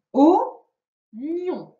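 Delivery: "oignon" very slow, broken into two separate syllables; "oignon" pulled apart for counting; split by syllables. Shown as a demonstration. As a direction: say "oignon" syllable by syllable, with a clear gap. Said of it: "dans la deuxième syllabe".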